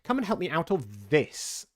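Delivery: mildly bossy rabbit; cross order. A narrator's reading. Angry rabbit orders servant.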